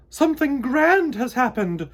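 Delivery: high, loud royal proclamation; queen announcing news to her court; grand announcement. A narrator's reading. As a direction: announce it to the whole hive. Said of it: "she announced".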